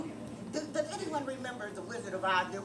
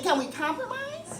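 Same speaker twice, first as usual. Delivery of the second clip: laughing plea; 12 semitones higher than usual. Retold or imitated. Pleading with the vegan.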